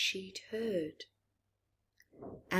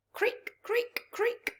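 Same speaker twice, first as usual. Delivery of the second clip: breathy creak rising to a high squeak; high squeaky creaking noise; creaking wheel; slower than usual. Acted.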